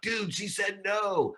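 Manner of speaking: high, slow mocking shout; retold yell. Boy yelling at the kid who got turned down. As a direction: yell it out across the camp. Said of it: "embarrassed me and yelled out".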